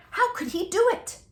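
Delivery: ordinary delivery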